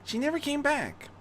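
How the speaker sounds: put-on high, quick boy's voice; high-pitched kid pointing it out; young boy protesting. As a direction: pipe up fast like an eager kid. Terry continues protesting.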